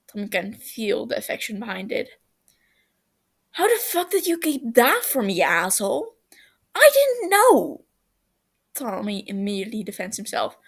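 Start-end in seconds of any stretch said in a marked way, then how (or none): 3.5-6.2 high and loud, mock furious; you hid this from me?!; outraged accusation. Tubbo outraged at secret. 6.7-7.8 very high, loud and slow, almost a squeal; frantic defence. Acted. Tommy defends himself.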